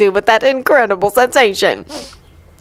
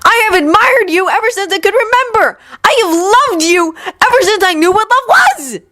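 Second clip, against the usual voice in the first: high, raised impassioned voice; passionate confession; 13 semitones higher than usual. Acted. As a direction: declare it with all he has.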